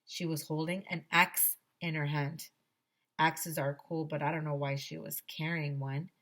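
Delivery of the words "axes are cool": usual speaking voice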